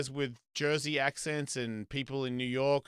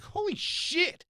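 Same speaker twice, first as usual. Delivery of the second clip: astonished; much slower than usual. Retold or imitated. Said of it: "and I'm like".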